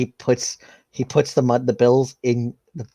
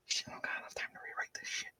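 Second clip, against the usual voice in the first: fast frantic whisper; panicked whisper, no time to fix the paper; 22 dB quieter, faster than usual. Acted. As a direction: rush it out under your breath. Vinny panicking under his breath.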